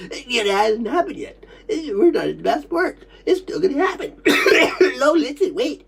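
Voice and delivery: high, distressed voice broken by coughing; tearful, choking reliving. Retold or imitated. Recalling being laughed at while coughing, high choked voice. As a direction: relive it upset, catching your breath between coughs.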